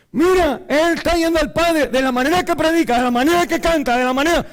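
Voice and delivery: high and loud, pressing on; fervent preaching. Real, not acted. Preacher exhorting the church at full volume. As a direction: hammer each phrase home.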